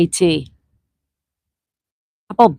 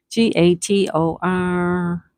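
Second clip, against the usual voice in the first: slow letter-by-letter spelling; much slower than usual. Shown as a demonstration. Host spells out the word for the players, slowly.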